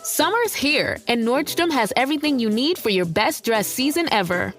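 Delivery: ordinary delivery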